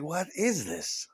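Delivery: drawn-out puzzled disbelief; lightly mocking bafflement; slow baffled question. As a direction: stretch it out, truly confused. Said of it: "it was like".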